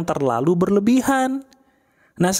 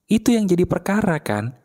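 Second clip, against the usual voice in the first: ordinary talk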